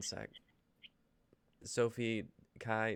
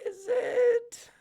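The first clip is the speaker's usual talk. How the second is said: much higher, louder cracked squeal; squeaky disbelief; 6 dB louder than usual. Acted. Coworker doubting the writer's claim, squeaky disbelief. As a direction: screech it in disbelief.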